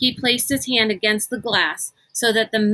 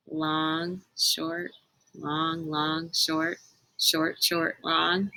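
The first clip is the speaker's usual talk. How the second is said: quiet counting; 4 semitones lower, 7 dB quieter, much slower than usual. Acted. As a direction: murmur the long and short beats softly. Kate decoding honks softly.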